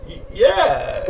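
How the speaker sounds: high-pitched excited yeah; imitated excitement. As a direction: imitate a man getting excited. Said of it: "every time he get excited".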